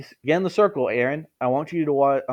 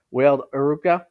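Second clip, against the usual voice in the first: ordinary delivery, nothing marked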